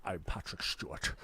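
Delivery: low, hushed actor impression; imitating an authoritative stage actor; Patrick Stewart. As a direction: drop into a soft, authoritative voice. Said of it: "That's how Patrick Stewart talks".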